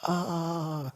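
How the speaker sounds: high, long cry of pain; acted scream. A narrator's reading. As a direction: hold a high mock wail. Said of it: "could hear him screaming".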